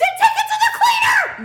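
high, loud furious yell through a laugh; yelling at her husband over his smelly shirts; angry yell. Retold or imitated. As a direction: blast it at him in outrage. Retorting to husband's excuse.

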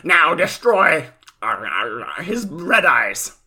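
drawn-out mock villain order to Joey; villain monologue.

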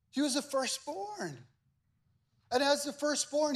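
high, measured sermon cadence with pauses; emphatic preaching. Real, not acted. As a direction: press each phrase on the congregation. Preaching with full intensity.

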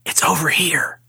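eerie whispered ghost voice; creepy unvoiced-sounding beckoning whisper; ghostly voice. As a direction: whisper it like a voice in the dark. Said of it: "I hear this voice go".

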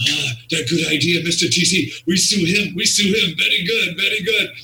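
accented broken-English imitation, climbing in pitch; heavy-accent character voice, rising as he repeats; Iron Sheik. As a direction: imitate a foreign wrestler's delighted approval. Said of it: "I never forget Sheik saying".

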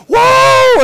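loud, high whoop; crowd cheer. Retold or imitated. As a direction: cheer like the whole stadium. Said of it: "everybody go".

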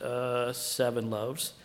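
low, soft, hesitant answer; sheepish disciples. Acted. Disciples answering how many loaves were left, sheepish and low. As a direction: answer slowly and dully, like slow pupils.

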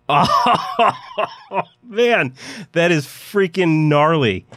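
high, laughing groan of disgust; slow, laughing over the stench. Acted. Ron hit by the smell.

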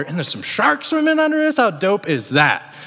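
high-pitched thrilled exclamation; excited. Retold or imitated. Guest spotting sharks from the pier, excited. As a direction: marvel at it, pitch jumping up. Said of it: "like, excitement".

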